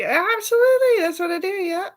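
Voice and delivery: very high, loud gushing yes; overexcited. Retold or imitated. Answering her proudly in a very high loud gush. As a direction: gush it with giddy pride. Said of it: "I was like".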